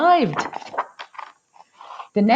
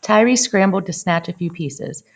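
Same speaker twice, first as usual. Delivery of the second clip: plain reading voice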